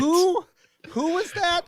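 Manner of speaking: high puzzled voice, acting confused; baffled, squinting at the screen; confused listener. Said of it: "they're like".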